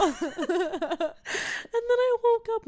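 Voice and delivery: slow, high laughing words; laughing too hard to talk. Real, not acted. Laughing while telling story.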